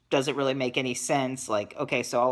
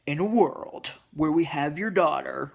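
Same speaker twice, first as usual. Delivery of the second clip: lightly done movie-trailer announcer; a hint of the dramatic trailer narrator; mock movie phone announcer opening line. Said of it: "trying to be Mister Movie Phone".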